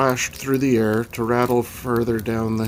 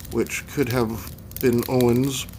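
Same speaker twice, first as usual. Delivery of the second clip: ordinary delivery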